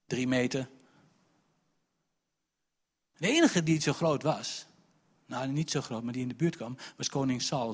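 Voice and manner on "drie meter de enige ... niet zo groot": usual speaking voice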